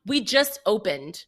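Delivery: drawn-out, condescending put-down; snooty attitude. Retold or imitated. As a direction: imitate a flamboyant bartender with attitude. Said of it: "with such an attitude".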